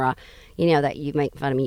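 ordinary talk, nothing marked

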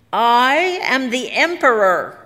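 high, loud, drawn-out proclamation; booming self-crowned tyrant; imperious cry. A narrator's reading. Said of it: "cried Harrison".

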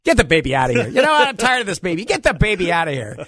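loud, high mock Trump snapping; imitating Trump. Host imitating Trump at a rally, fed up with the baby. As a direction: ham up an annoyed Trump. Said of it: "He says".

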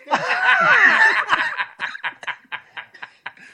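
long high cackle of laughter; high shrieking laugh. Real, not acted. Caller cracking up at his own punchline, long high laugh. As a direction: lose it laughing.

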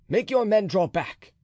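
urgent high call; cried. A narrator's reading. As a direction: call it out sharply to the men. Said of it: "cried D'Artagnan".

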